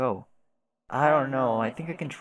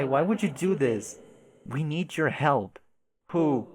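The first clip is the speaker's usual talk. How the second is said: normal talking voice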